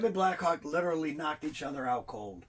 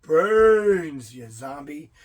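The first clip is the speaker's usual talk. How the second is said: zombie voice; 3 semitones lower, 10 dB louder, much slower than usual. Retold or imitated. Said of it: "I keep expecting him to go".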